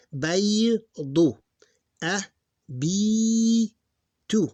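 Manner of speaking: Baydu, A, B sounded out with B held long and high; teacher drawing out the long sound. Shown as a demonstration. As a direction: stretch the B like a reading teacher. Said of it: "That's long sound".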